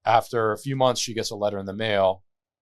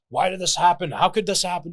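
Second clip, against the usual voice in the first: mock anxious rant; 10 semitones higher, 8 dB louder than usual. Mimicking anxious thoughts about work.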